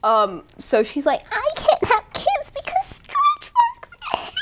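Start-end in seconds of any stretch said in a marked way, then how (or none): 1.3-4.3 high, slow, hammed-up wail; mock whining woman. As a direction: wail it in mock despair, half laughing. Mocking a woman fearing stretch marks.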